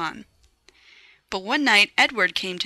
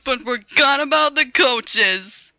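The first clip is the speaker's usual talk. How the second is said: mocking; 6 semitones higher, 5 dB louder than usual. A narrator's reading. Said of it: "the other engines laughed".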